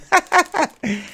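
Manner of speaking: a real laugh that jumps way up high; high burst of laughter. Real, not acted. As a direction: let out a high, delighted laugh. Laughing at a friend's joke.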